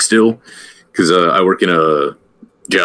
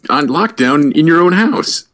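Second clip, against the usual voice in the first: incredulous; 8 semitones higher than usual. Real, not acted. Incredulous reply to cohost.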